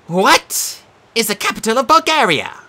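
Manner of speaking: high, loud, slow quiz question; game show host. Acted. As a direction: read the question like TV. Sphinx asks quiz question.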